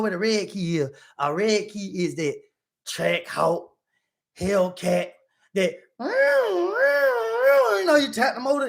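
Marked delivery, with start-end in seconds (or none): 5.5-8.0 engine rev imitation. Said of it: "that's how it sound".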